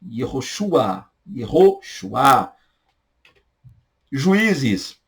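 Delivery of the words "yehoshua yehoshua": Yehoshua drawn out slowly, ye-ho-shu-a; slow Hebrew name. Shown as a demonstration. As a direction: stretch Yehoshua for the class. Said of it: "tá".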